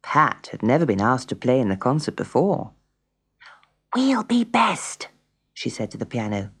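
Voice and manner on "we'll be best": put-on high, slow child's voice; confiding little girl. A narrator's reading. Pat speaking to her piano friend, confiding and high. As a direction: confide slowly, pitched high.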